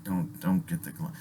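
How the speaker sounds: very quiet, low aside; hushed warning. Retold or imitated. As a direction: say it low, under your breath. Silent warning while watching trouble start.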